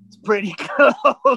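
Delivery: slow words breaking into a laugh; laughing understatement. Retold or imitated. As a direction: laugh through it as you play down the danger. Laughs retelling a near miss.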